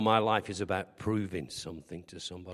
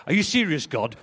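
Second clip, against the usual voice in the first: higher, louder, daring demand; loud challenge; 5 semitones higher, 7 dB louder than usual. Acted. Preacher daring congregation to ask God, loud challenge. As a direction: call it out boldly, as a dare.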